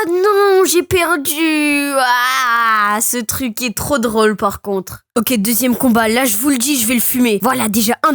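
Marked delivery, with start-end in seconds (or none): 0.1-3.0 long, slow "nooo" sliding down; wail of defeat. Real, not acted. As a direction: wail it out as you lose the match. Loses the boxing match.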